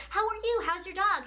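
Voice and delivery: high, chirpy small-talk questions; imitating friendly barista. Starbucks staff chatting to a drive-thru customer, high and friendly.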